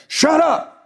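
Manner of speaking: dad snapping at the child, hammed up; imitated yell. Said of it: "my dad was like".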